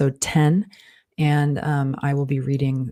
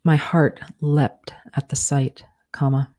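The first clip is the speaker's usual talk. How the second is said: slow dictation, punctuation spoken aloud; dictating; slower than usual. Shown as a demonstration. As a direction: dictate it word by word with pauses.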